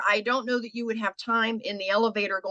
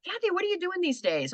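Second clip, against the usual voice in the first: much higher, curious party guest; party guest asking; 11 semitones higher than usual. Retold or imitated. Christmas party guest asking Kathy, higher and curious. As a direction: play a friend at a Christmas party. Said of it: "somebody goes".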